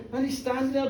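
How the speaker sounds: ordinary delivery, nothing marked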